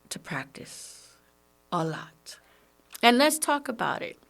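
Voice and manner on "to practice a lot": hushed, slow whisper. Real, not acted. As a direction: drop to a whisper to stress it. Whispered emphasis.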